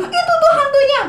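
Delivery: high, frightened pointing out; scared pointing. Pretend. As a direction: point at the ghost in fear. Disciple pointing out ghost.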